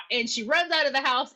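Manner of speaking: usual speaking voice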